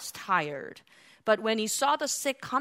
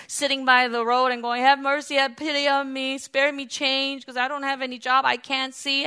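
louder, higher preacher's delivery; raised pulpit voice; forceful preaching; 4 semitones higher, 7 dB louder than usual. Real, not acted. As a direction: preach it out in a driving, insistent stream.

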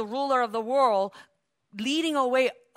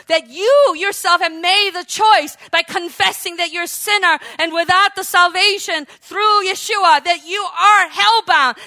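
loud, raised preacher's cry; fervent full-force preaching; 5 semitones higher, 8 dB louder than usual. Real, not acted. Preacher urging believers to claim their Savior, fervent exhortation. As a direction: exhort the crowd with mounting fire.

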